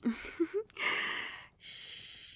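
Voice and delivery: high little giggle trailing into breath; tender giggle. Acted. Queen holding her boy safe, tender giggle. Cuddling partner close.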